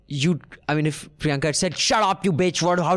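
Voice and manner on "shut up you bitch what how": higher-pitched mock angry snap; hammed-up abusive outburst, tumbling out. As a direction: pretend to blow up at a heckler. Hypothetical angry outburst at heckler.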